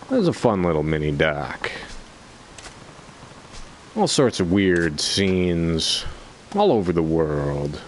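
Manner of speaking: normal talking voice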